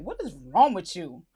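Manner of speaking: low, deliberate reproach; stern alternative take. Acted. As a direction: ask it low, pressing on WRONG. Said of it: "how she meant it".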